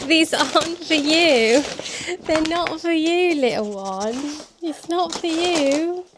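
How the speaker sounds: playful scolding; pet voice. Real, not acted. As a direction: gently tease the puppy away. Telling the dog off gently.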